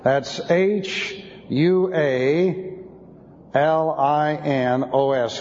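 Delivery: HUALINOS spelled slowly letter by letter. Shown as a demonstration. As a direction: dictate each letter to the class. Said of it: "Looks like this".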